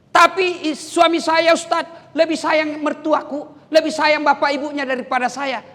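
high-pitched wifely grievance; complaining wife. Acted. Wife telling preacher her husband prefers his parents, high complaining.

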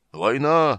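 high, loud battle cry; trumpeting. A narrator's reading. Newly woken dragon announcing war, trumpeting. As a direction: sound it out like a horn. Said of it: "затрубил он".

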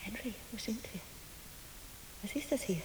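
soft, hushed frightened question; scared whisper. Acted. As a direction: whisper it lost and afraid. Verängstigte Frau flüstert. (verängstigtes Flüstern)

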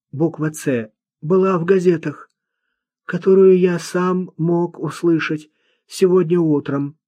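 high-pitched, laboured recital of points; odd, earnest young man. A narrator's reading. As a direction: voice a simple lad trying to speak properly.

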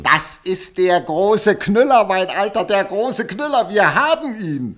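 loud, triumphant announcement of the scoop; thrilled. Acted. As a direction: crow over the big story.

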